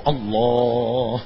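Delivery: اللہ held long and slow, the final h silent; correct Allah. Shown as a demonstration. Teacher showing how a lone Allah is said, slow. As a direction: draw out اللہ slowly and close without a vowel. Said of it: "یعنی جب صرف اللہ کہیں".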